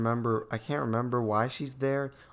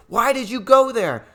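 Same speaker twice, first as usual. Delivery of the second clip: high, loud outburst of disbelief; exasperated yell; 10 semitones higher, 11 dB louder than usual. Real, not acted. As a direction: yell it, mad at their stupid choice. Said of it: "getting hoarse from getting mad".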